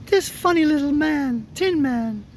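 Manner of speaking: high little mouse voice; squeaky mouse queen. Acted. Mouse queen presenting the Tin Man, high squeak. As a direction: answer as a tiny queen. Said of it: "she answered".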